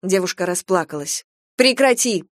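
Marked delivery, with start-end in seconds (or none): 1.6-2.2 commanding. A narrator's reading. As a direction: bark the order. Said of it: "приказала Венка".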